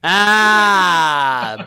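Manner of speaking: usual speaking voice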